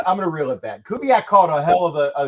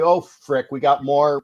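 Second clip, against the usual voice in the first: ordinary delivery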